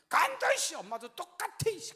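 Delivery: put-on high, loud sulky child's shout; angry yell. Son shouting at mom as he storms off, high angry yell. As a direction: yell it like a kid slamming off to his room.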